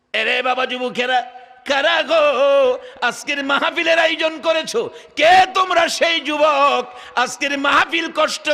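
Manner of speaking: high, ringing address to the crowd; full-force preaching. Real, not acted.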